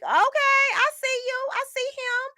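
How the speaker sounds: very high squeal of recognition; squeaky excited recall. Retold or imitated. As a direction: squeal it like gossip confirmed. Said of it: "I was like".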